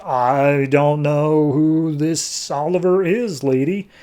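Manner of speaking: slow, drawn-out denial; feigned ignorance. Acted. Green Arrow denies knowing who Oliver is, feigned ignorance. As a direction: play dumb, stretching the words out.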